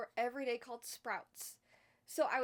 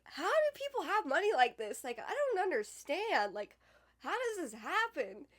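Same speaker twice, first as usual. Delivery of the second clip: higher and louder, puzzled disbelief; confused disbelief; 6 semitones higher, 5 dB louder than usual. Retold or imitated. Staring at rich houses, higher, louder disbelief. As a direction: gawk at the mansions out loud. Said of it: "I'd be like".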